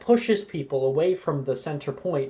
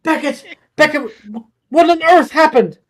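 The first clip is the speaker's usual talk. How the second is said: high, loud worried calls; alarmed calling out; 7 semitones higher, 6 dB louder, faster than usual. Acted. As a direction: rush over calling his name. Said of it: "Rojas is going over".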